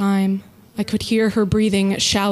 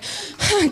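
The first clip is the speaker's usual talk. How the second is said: long fake bored sigh; 10 semitones higher than usual. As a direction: sigh like the shift is endless. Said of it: "affecting a bored sigh".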